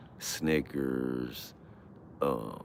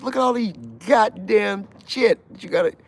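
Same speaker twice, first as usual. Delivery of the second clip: high, loud barking guard; mock guard yell; 8 dB louder than usual. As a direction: bellow like a prison guard. Guard yelling during search.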